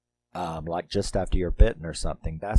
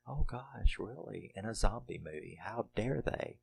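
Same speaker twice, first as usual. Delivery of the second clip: sarcastic; 3 semitones higher, 9 dB quieter than usual. Retold or imitated. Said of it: "And I'm like".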